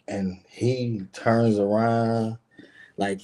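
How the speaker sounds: ordinary talk, nothing marked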